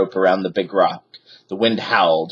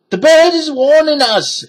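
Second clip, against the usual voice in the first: very high, loud scared pirate; shouted; 6 dB louder than usual. A narrator's reading. Pirate panics at the parrot, high loud shout. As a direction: cry out in alarm. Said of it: "shouted Pinky".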